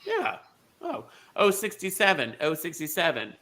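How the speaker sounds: usual speaking voice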